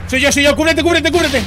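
loud, high warning yell; urgent shout. Real, not acted. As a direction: yell at them to get down. Warning teammates to take cover.

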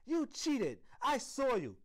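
high, loud accusing yell; angry child's high-pitched shout. A narrator's reading. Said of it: "she yelled".

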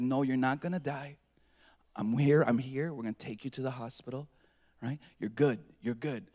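very low, hushed fatherly comfort; quiet, steady retold promise; imitating calm reassurance. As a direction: soothe a hurt child softly. Reassures bleeding son.

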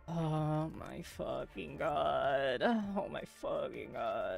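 slow, drawn-out oh my god; dragged-out groan of dismay; exasperated groan. Real, not acted. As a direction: stretch it out in disbelief. Game disaster, drawn out groan.

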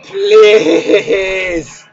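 laughing plea. Real, not acted. Desperate plea, laugh heard.

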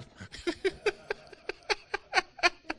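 long high laugh at his own story. Real, not acted. Preacher laughing at his football story, high laugh.

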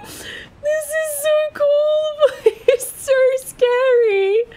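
very high, loud, slow excited squeal with a laugh; real high excited squeal, laughing; thrilled squeal. Real, not acted. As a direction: shriek with delight and fear. Thrilled and spooked in woods.

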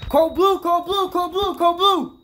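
loud frantic code call; urgent shout. Acted. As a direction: call the code at full volume. Hospital emergency code called out.

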